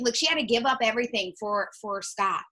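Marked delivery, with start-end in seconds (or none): none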